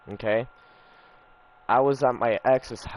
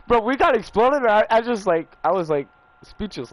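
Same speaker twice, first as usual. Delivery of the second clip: laughing, much higher and louder; excited gaming outburst; 12 semitones higher, 7 dB louder than usual. Real, not acted. Getting exploded mid-game, real laughing outburst. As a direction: yelp with real shock at getting blown up.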